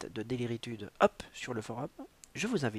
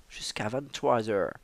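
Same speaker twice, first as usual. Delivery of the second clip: ordinary delivery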